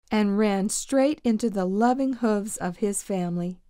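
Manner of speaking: ordinary delivery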